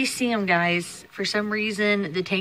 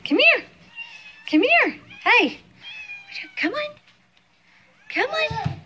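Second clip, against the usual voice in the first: sing-song calling; 4 dB louder than usual. Real, not acted. Mom calling baby to come over, high sing-song call.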